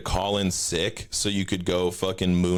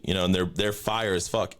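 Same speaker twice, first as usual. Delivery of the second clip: ordinary talk, nothing marked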